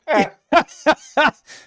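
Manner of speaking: loud high delighted laugh; burst of laughter. Real, not acted. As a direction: break into a big happy laugh. Laughs recalling meeting hero.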